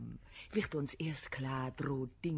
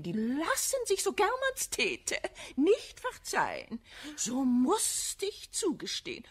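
mock pained; 10 semitones higher, 4 dB louder than usual. Mock-pained moral indignation.

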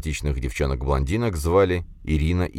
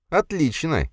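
predatory relish; 11 semitones higher, slower than usual. A narrator's reading. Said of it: "хищно оскалился я".

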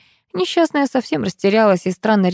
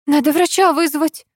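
alarmed; 6 semitones higher than usual. Acted. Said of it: "испугалась жена Олега".

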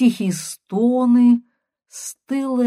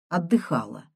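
ordinary delivery

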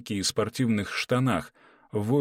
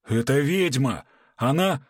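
panicked shout; 5 semitones higher, 5 dB louder than usual. A narrator's reading. Менеджер кричит в панике.

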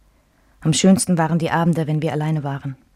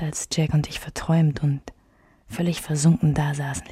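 ordinary delivery

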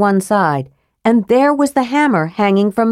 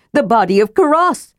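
"The body of Karas" pitched up and stressed; "Karas" given deliberate, higher emphasis; pointed emphasis; 4 semitones higher than usual. A narrator's reading. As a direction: lay meaningful weight on "Karas". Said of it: "He laid emphasis on that".

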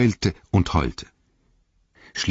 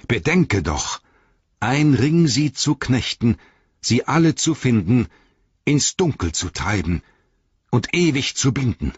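higher, measured, stern incantation; 7 semitones higher, 4 dB louder than usual. A narrator's reading. Frodo warning Gollum with the Ring's rhyme, stern. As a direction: recite it as a grave warning, pausing between phrases. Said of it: "die Strenge seines Tons".

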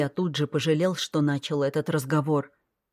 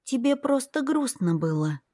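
put-on comforting little girl; 7 semitones higher than usual. A narrator's reading. Little girl tells her brother he is not bad, gently. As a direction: reassure him sincerely.

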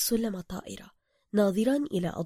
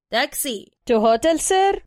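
taxi tout; 6 semitones higher, 9 dB louder, much slower than usual. Acted. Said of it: "قائلا بالإنجليزية".

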